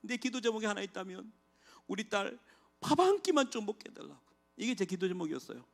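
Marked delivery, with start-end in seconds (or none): 0.0-4.2 high, pleading prayer; father begging God for one meal; desperate prayer. Retold or imitated. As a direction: beg God with a breaking voice. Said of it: "그러면서 하나님께".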